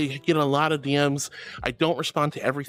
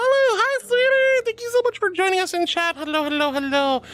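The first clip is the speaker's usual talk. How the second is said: cutesy squeal; 14 semitones higher, 3 dB louder than usual. Real, not acted. Cooing hello to a new follower.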